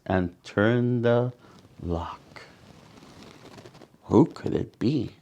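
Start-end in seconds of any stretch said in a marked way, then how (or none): none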